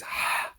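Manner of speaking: "ha" as pure breath from the throat; het sound. Shown as a demonstration. Teacher showing the het sound, breathed. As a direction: breathe out "ha" like fogging glasses. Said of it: "it's the equivalent of het".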